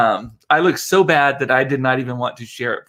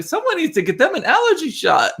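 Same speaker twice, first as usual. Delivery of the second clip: much higher, playful mock cry; mock exclamation; faster than usual. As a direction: squeal it out as a joke. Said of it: "I was like".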